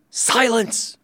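ordinary delivery, nothing marked

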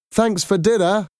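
high, called-out goodbye across the flat; children shouting goodbye. Acted. Children call goodbye to their dad as they leave, shouting. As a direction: call it back over your shoulder. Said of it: "they shouted as they left the flat".

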